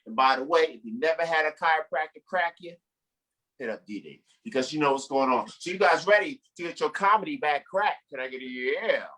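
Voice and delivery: normal talking voice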